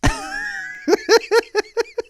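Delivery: a squealing burst of laughter; high shrieking laugh. Real, not acted. Laughing at imagined crew, high shrieking laugh.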